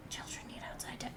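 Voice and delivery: put-on Children need outside time whispered fast and breathless. Shown as a demonstration. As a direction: hiss the line under your breath, fast. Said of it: "repeated line, whispered take".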